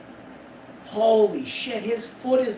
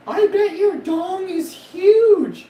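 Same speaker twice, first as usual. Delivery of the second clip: mock girl voice; 10 dB louder, much slower than usual. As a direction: stretch it out in a squeaky girly tease.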